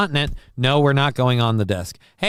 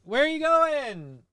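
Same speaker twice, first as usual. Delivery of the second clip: much higher, slow sing-song to a pet; baby talk to cat; slower than usual. Pretend. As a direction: coo at the cat as it wanders off. High voice to the cat.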